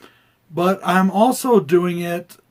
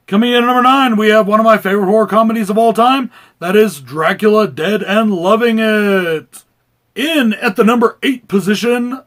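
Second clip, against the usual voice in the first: ring announcer; 6 semitones higher, 9 dB louder than usual. Acted. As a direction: hype it like a boxing announcer. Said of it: "Is this voice getting annoying yet?".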